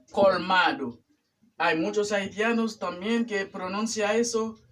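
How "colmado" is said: "Colmado" with the final o sounded; "Colmado" keeping its last vowel; correct full ending. Shown as a demonstration. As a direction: say "Colmado" in three syllables, col-MA-do. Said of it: "es colmado".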